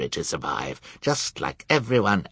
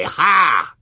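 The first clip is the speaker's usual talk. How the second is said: scornful ha; 9 semitones higher, 8 dB louder than usual. A narrator's reading. Villain scoffs at guests.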